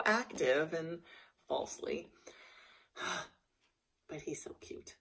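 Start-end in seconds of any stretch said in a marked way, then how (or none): 3.0-5.0 soft sighing whisper; doting whisper. Real, not acted. Owner sighing about her lame but cute horse, soft whisper. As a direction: breathe it out, melting over him.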